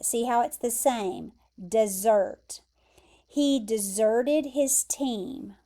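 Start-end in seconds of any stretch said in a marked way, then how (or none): 1.6-2.6 "Desert" as duh-ZERT, stress on the end; verb desert, like dessert. Shown as a demonstration. Teacher showing the verb sounds the same, "Desert" stressed on ZERT. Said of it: "pronounce it like this word".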